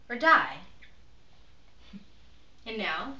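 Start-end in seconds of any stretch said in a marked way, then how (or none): 0.1-0.6 emphatic declaration. Acted. Her motto's final word. 0.6-2.6 quiet, triumphant chuckle under breath; a hushed, satisfied exhale of a laugh; soft breathy laugh. Acted. Laughs after win or die.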